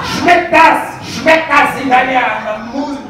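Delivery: loud, high demanding German; German dirty talk. Acted. Comic acts out German dirty talk, shouting lewdly. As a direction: yell it like overexcited German bedroom talk. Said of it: "I do dirty talk in German".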